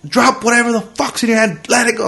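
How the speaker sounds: high, strained cop command; mock police shout without full volume; imitated yelling. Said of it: "he's yelling something at me".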